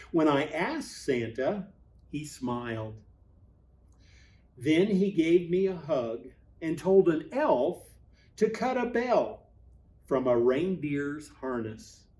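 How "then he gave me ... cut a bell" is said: ordinary delivery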